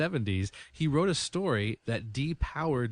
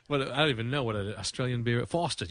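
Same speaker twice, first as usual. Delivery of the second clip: usual speaking voice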